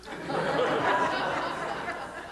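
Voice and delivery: breathless high laugh at the absurdity; real laugh. Real, not acted. Rabbi laughing at his own story, airy high laugh.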